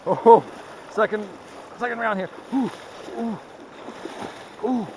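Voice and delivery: gasping while wading icy water; in ice cold water. Real, not acted. Wading through cold rapids.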